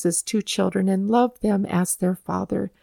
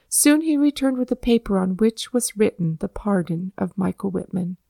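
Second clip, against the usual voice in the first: usual speaking voice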